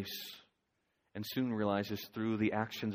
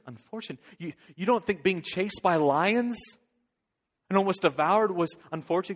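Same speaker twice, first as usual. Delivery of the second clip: indignant disbelief; 8 semitones higher, 8 dB louder than usual. Acted. Shasta protests the thing's verdict.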